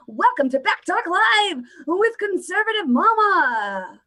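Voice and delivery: slow, high, swooping show intro; show-opening announcer. Pretend. Host announcing her live show, hammed-up drawn-out intro. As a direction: stretch it out like a grand entrance. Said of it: "That's how I'm gonna enter every show".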